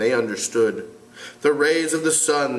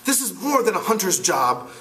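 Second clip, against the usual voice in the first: put-on boy voice; 8 semitones higher than usual. Ralph voiced.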